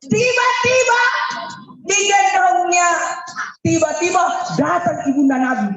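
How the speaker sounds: high, loud sudden cry; excited preacher's shout; loud dramatic preaching. Acted. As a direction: shout out the surprise.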